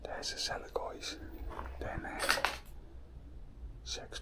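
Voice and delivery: soft whisper while exploring; hushed whisper. Real, not acted. Explorer pointing out the cellar to viewers, whispered. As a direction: keep it hushed, as if someone might hear.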